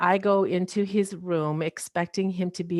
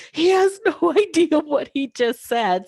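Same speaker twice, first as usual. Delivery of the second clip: high, louder, giggling through the words; laughing disbelief; 8 semitones higher, 5 dB louder than usual. Retold or imitated. Mother and daughter share a look, laughing in disbelief. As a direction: laugh in disbelief at the doctor's remark. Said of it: "my mom and I are looking at each other like".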